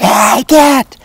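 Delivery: panicked yell at the attacking dog; the too-loud version to avoid. Shown as a demonstration. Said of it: "not necessarily maybe your voice quite as raised as mine was".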